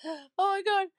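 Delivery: high frantic shout for him; panicked yell. Retold or imitated. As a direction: call out in a panic, loud and high. Calling for missing husband.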